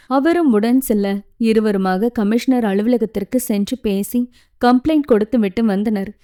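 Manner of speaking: usual speaking voice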